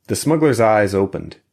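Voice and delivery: plain reading voice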